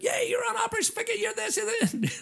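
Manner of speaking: very high squealing imitation of excited kids; crazy excited kids. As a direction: mimic a crowd of kids going wild, high-pitched. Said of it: "all the kids were crazy".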